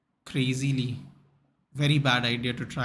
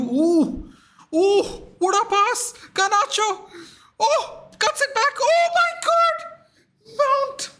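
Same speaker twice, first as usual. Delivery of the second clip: high excited shrieks at a near goal; excited shrieking; 6 dB louder, slower than usual. Real, not acted. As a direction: shriek like a jackpot winner. Live football match chance, yelling.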